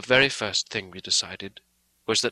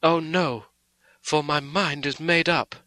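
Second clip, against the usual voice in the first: higher, louder, fervent resolve; devoted martyr; 5 semitones higher, 4 dB louder than usual. Acted. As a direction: answer with shining devotion. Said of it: "eyes shone with the devotion of a martyr".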